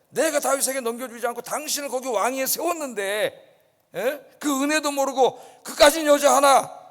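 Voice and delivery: scornful tirade at the ungrateful king; angry general. Acted. General berating the king over a woman, loud. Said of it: "아브넬이 화가 났어요".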